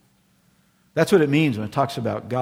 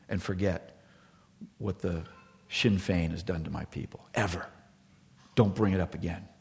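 put-on lower, softer, grave vow of an old elder; stern elder's vow; 4 semitones lower, 7 dB quieter than usual. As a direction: church elder refusing to forgive, quiet and grave. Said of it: "he looked at me in the eye and he said".

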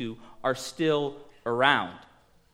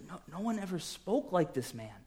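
hushed awe; 3 semitones higher, 9 dB quieter than usual. Acted. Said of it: "the only response to their anger is".

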